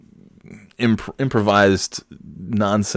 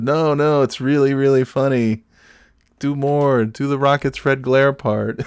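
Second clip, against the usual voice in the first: imitating wife; 6 semitones higher than usual. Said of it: "And she said".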